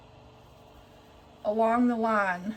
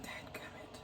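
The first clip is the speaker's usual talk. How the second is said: whispered curse; 21 dB quieter than usual. Real, not acted. Pin trouble while sewing.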